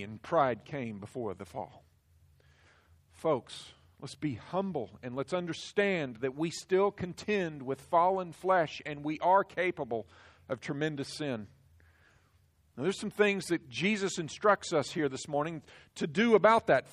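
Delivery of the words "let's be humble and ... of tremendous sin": usual speaking voice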